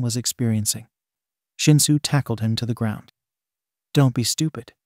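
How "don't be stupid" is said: usual speaking voice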